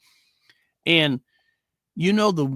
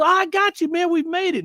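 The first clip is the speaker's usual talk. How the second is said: much higher, reassuring the patient; 3 dB louder than usual. Retold or imitated. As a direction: lift your voice to comfort him. Retold paramedic reassuring patient.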